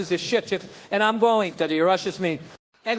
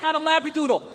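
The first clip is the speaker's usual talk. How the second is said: imitating the trainer; 8 semitones higher than usual. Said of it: "He goes".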